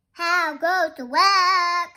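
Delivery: loud, slow singsong farmer's call; singsong shout across the farmyard. Acted. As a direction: holler it high and drawn out. Repeats farmer's call from story.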